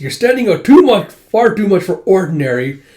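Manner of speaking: high, loud acted protest; exasperated complaint. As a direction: scold a companion, starting high then easing. Acted complaint to disguised friend.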